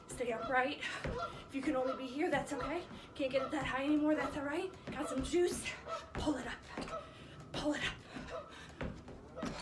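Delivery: loud, driving workout encouragement; barking gym coach. Real, not acted. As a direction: push them through the last reps. Workout coach pushing the class on.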